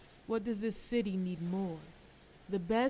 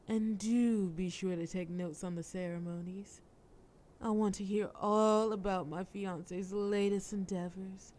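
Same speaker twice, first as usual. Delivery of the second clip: ordinary delivery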